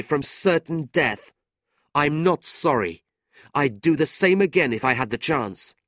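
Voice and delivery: loud, firm defiance; defiant young man. A narrator's reading. Nicholas defiantly defends himself to his family. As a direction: stand your ground.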